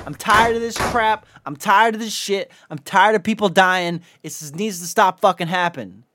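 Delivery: real fed-up venting; pissed off. Real, not acted. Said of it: "I'm getting pissed the fuck off".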